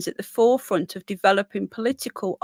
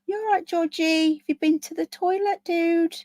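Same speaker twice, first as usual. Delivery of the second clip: pet talk; 8 semitones higher than usual. Real, not acted. Talking to her dog.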